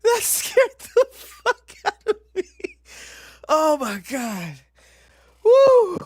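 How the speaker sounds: high, loud, laughing almost crying; shrieking laughter. Real, not acted. Streamer laughing and half crying after screaming. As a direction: squeal it out breathless.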